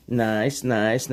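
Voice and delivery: lightly put-on Borat accent. Streamer amusing the chat, half-hearted Borat accent. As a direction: attempt a loose Borat-style accent. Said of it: "trying to sound like Borat".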